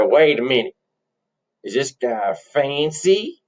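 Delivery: slow rustic questioning; countrified, dawning suspicion; suspicious country bumpkin. Acted. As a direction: drag out the question like a farm boy. Plays countrified twin brother.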